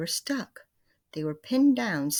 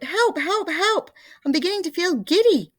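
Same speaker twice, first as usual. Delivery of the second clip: very high, loud shrieks; screaming for help; 13 semitones higher, 9 dB louder than usual. A narrator's reading. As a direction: shriek in dizzy panic. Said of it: "screamed Missus Twit".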